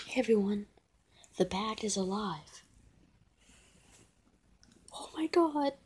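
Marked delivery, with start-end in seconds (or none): none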